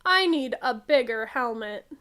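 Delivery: plain reading voice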